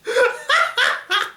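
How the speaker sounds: high, loud burst of laughter; real high cackling laugh. Real, not acted. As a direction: crack up at your own silly voice. Cracks up at own impression.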